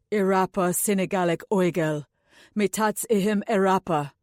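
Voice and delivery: a lightly intoned spell; incantation. A narrator's reading. Anulan casts the spell over the circle, lightly intoned. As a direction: chant the words of the rite.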